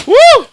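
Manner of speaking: short, high, loud whoop; excited whoop. Real, not acted. Streamer thrilled by the unboxing, whooping. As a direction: let out a piercing woo.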